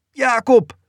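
high, alarmed scream of a name. A narrator's reading. Mozes calls to his brother as he is seized, screaming. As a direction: cry out high as if being grabbed. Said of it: "schreeuwde Mozes".

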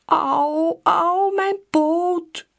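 high, drawn-out whimper. A narrator's reading. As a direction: moan about the hurt paw. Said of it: "jammert Loebas".

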